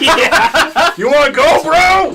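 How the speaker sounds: loud, high taunting swagger; fake tough guy swagger. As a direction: puff up and taunt back. Minion challenging to fight.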